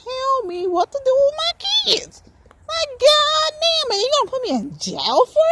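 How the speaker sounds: fed up, stretch it out; exasperated. Real, not acted. Ranting about jail threat.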